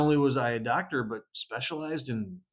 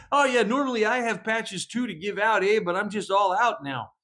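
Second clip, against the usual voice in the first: put-on Canadian accent; 6 semitones higher than usual. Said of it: "'Cause he".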